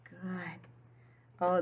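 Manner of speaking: ordinary talk, nothing marked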